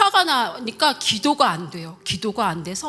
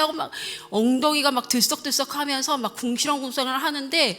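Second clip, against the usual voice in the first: normal talking voice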